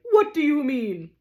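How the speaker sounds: indignant challenge; outraged tragedian. Acted.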